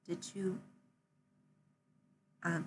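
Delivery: hesitant, quiet, long halting pauses; stumbling over words. Acted. Masked character shyly offering company, halting and soft. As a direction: trail off softly between false starts. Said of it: "they're kinda stumbling over their words".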